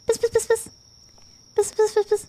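high, sing-song cat call; playful high piss piss call. Real, not acted. Calling the cat in silly way.

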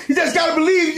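ordinary talk

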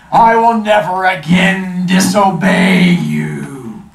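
booming slow genie; loud, drawn-out promise. Acted. Genie vowing obedience.

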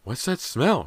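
high, sharp demand. A narrator's reading. Mother sniffing at her daughter, demanding. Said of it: "she demanded".

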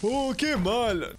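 slow, drawn-out cartoon lament; Homer. Player acts out the Homer video, mournful cartoon voice. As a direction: stretch out the mock dismay. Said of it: "Esto es como el vídeo de Homer".

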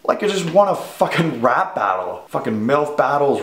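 ordinary talk, nothing marked

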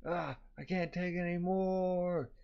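high, slow, drawn-out mock wail; berserk wail. A narrator's reading. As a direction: stretch the last word into a whine.

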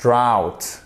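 "Drought" with the ou sound of out; "Drought" pronunciation. Shown as a demonstration. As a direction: say "Drought" to rhyme with out. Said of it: "C'est le même son que vous avez".

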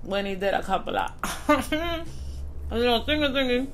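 talking while chewing; mouth full of sandwich. Real, not acted. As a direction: between bites of food. Seen 3: Holds sandwich throughout, bites and chews it while talking.

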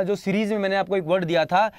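ordinary talk, nothing marked

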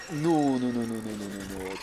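drawn run of no's sinking and fading; falling, softening string of no's; dismay. Real, not acted. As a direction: let it trail down into a low murmur.

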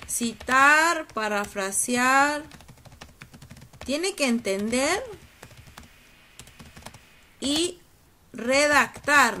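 drawn-out words with long pauses; slow dictation. Real, not acted. Teacher writing out what students must do, slow dictation. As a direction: dictate word by word, stretching each one.

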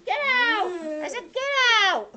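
very high, very loud shout; furious little boy ordering monsters out; really angry yell. A narrator's reading. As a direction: yell it at full force. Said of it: "He's really angry".

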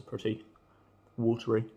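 talking while biting food; speaking through chewing; mouth full of sandwich. Real, not acted. Seen 1: talks holding bitten sandwich, then bites into it mid-sentence.

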